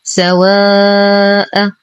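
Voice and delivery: سواء with the aa held very long before the hamza; long madd. Shown as a demonstration. Teacher demonstrates elongation, long held aa in سواء. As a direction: stretch the alif of سواء slowly. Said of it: "يمد بمقدار أربع إلى خمس حركات".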